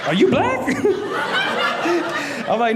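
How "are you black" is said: much higher voice, then a laugh; high amazed question. Retold or imitated. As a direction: ask high and giggle. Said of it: "His voice went up even higher".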